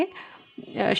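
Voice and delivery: ordinary delivery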